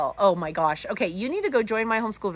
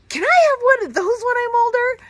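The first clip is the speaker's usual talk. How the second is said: put-on much higher, louder kid voice asking hopefully; child's voice; 9 dB louder than usual. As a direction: imitate a ten-year-old begging for the car. Son asks for expensive car.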